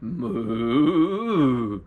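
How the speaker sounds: long, drawn-out moo; a convincing storyteller's moo; imitated cow moo. A narrator's reading. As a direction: call out like a lost cow. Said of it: "Sounded just like them other cows".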